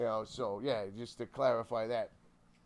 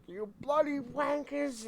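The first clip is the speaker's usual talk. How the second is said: high, drawn-out British scolding; mock British outrage, pitched up; thick British accent; 13 semitones higher, 4 dB louder, slower than usual. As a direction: imitate an irate British grandma, slowly. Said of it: "Thick British accent".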